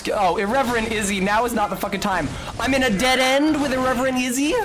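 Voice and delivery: high-pitched outburst, rising to a peak; real exasperation, pitched well up; exasperated protest. Real, not acted. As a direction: protest in alarm at being cornered in the game. Cornered in game, protesting.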